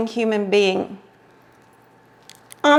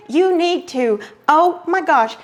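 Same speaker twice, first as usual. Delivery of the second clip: ordinary delivery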